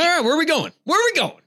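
high-pitched, pumped-up eagerness; raring to go, voice pitched right up; all fired up. Real, not acted. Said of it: "I get you all fired up".

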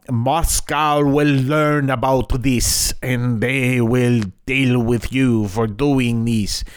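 put-on slow, hammy Russian accent; bad Russian accent. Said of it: "pardon my really bad Russian accent".

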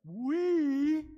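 long, high, booming WE; big drawn-out shout. Shown as a demonstration. Preacher rallying the congregation to repeat, long loud shout. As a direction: hold WE out at full volume. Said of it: "That's so weak".

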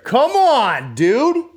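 high, loud, exasperated outburst; exasperated yell. Real, not acted. Podcaster griping about coffee over here, exasperated yell. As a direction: cry out in loud disbelief.

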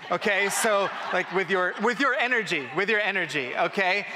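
rally them like a coach; energetic crowd hype; pumped-up hype. Real, not acted. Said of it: "hyping the crowd up".

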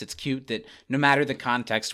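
normal talking voice

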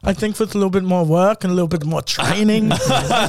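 mock German instructor; encouraging, put-on German-accented English. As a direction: give an appraisal in a comic German accent. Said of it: "your German accent".

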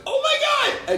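high mock squeal of excitement; imitating a scream on the plane; retold scream. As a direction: squeal it like the big news just hit. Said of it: "I said".

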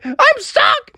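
very high, squealed mock wail; crying panic. Froggy cries behind the locked door, panicking. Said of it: "started to cry and panic".

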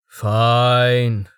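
drawn-out, sighing concession; resigned sigh. A narrator's reading. Man giving in to an insistent dog, sighing. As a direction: give in with a sigh. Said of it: "Tallheart said with a sigh".

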